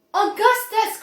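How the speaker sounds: high, loud alarmed call; crying out. Acted. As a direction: call out while hopping about. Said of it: "cried Mister Wonka".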